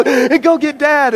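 high, drawn-out mock cry; panicked sisters. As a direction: imitate sisters raising the alarm slowly, high-pitched. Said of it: "my sisters are like".

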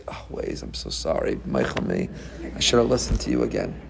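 hushed, low, sheepish murmur; mock contrite apology. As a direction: act out a humbled driver begging forgiveness softly. Acts out driver apologizing to Waze.

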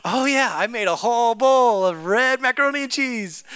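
put-on high, loud childlike voice; cheerful little girl. Daughter chirping her answer to mom. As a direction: chirp it like a carefree kid. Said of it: "She goes".

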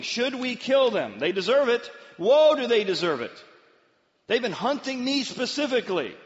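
high, loud indignant outburst; worked-up vengeance. Acted. Voicing the urge to kill captured enemies, worked-up. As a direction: exclaim it with hammed-up outrage.

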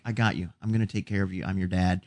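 soft, reassuring movie father; tender dad. Retold or imitated. Film dad promising to take care of his kid, soft and tender. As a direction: quote a dad quietly comforting his child. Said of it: "just when he goes".

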